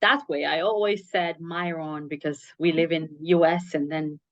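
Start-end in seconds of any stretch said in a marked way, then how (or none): 1.4-2.1 "Myron" with the I as in "eye"; American mispronunciation. Shown as a demonstration. Host showing her old mistake, "Myron" as MY-ron. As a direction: say "Myron" English-style, MY-ron. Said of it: "how we pronounce I".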